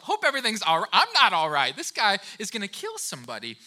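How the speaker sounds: put-on loud exasperated teen outburst; indignant retort. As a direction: throw his words back in irritation. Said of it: "And I thought to myself".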